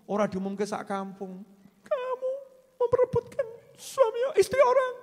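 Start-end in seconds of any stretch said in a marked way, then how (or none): 1.8-4.9 very high, mock tearful accusation; hammed-up wail at a rival; imitated accusing wife. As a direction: squeal it like a distraught wife.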